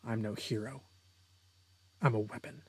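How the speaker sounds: soft, low-key threat; quiet menace. Acted. Hero answering the frightened villain, quietly menacing. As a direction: drop the volume for a cold declaration.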